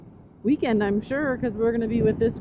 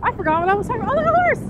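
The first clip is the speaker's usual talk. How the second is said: much higher and louder, jumping to the horse; excited outburst; 13 semitones higher, 8 dB louder, much faster than usual. Real, not acted. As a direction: lose the thread and cry out at the horse. Distracted again by a horse.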